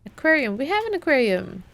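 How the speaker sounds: ordinary delivery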